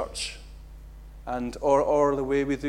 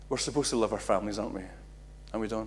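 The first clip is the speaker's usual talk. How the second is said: ordinary talk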